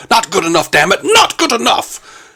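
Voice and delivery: lightly voiced stern captain, rising; imitating Picard's outburst; Picard impression. Picard quote acted.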